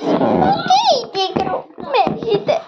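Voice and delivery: high little creature talking back; squeaky retort. Pretend. Little toy talking back to Tikru, high and squeaky. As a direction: toss it back high and sassy.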